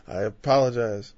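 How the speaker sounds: ordinary talk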